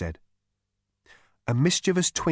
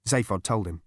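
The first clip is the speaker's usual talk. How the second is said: ordinary delivery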